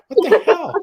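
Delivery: very high-pitched retold disbelief; astonished. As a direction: squeak it out in amazement at the coincidence. Teller's amazed reaction, retold.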